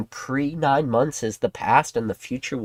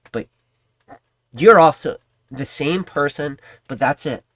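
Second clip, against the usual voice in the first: ordinary talk, nothing marked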